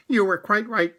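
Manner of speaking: the fussy rabbit conceding. Acted. Rabbit admitting Pooh was right. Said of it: "said Rabbit".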